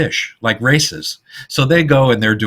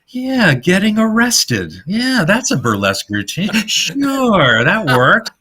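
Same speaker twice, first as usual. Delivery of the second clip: mock sarcasm; 10 semitones higher than usual. Writer's idea mocked sarcastically.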